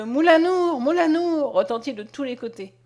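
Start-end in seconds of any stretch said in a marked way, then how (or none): 0.0-1.5 raised, higher shouted name; crowd's cry. A narrator's reading. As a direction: call it out like an alarm spreading. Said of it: "Le cri de".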